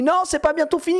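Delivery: high, plaintive pleading; mock whining patient. Dad whining as the patient while his ear is operated, high. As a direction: whimper like a patient in pain. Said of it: "Je fais".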